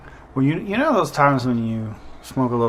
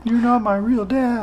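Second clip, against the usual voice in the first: put-on whiny high kid retort; sulky child talking back; petulant kid voice; 11 semitones higher than usual. Mock kid line to stepdad.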